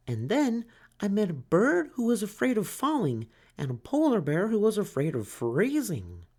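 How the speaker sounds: high, timid little turtle voice; small turtle Franklin. A narrator's reading. Franklin asks the duck for help, high and timid. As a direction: ask like a shy child.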